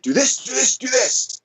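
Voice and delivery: high, urgent coaching yell, not full volume; rapid-fire cues in a mock yell; retold yelled cues. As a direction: reenact a coach calling out cues. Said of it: "I yelled out three cues".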